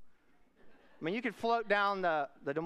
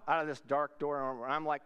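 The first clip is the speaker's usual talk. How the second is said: usual speaking voice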